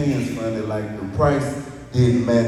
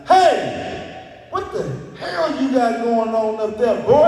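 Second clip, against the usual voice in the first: heckler yelling; 10 semitones higher, slower than usual. Retold or imitated. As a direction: yell like an annoyed guy in the line. Impatient customer yells from line.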